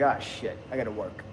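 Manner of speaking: the hype drains away at the gym; deflated groan. Retold or imitated. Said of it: "you're like".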